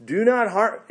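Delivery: loud, high cry to stop him; urgent loud cry of Paul. Acted. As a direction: shout to stop the jailer's sword. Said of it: "cried out".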